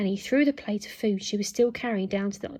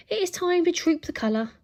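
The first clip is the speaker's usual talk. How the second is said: higher-pitched robotic butler proclamation; posh robot voice; 7 semitones higher, 4 dB louder than usual. A narrator's reading. Robot butler announces royal duties, posh robot voice. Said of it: "in its posh robot voice".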